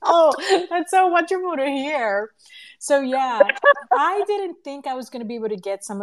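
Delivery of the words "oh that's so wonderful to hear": real laughter breaking with emotion; laughing through happy tears; laughing, touched. Real, not acted. Host moved by guest's words.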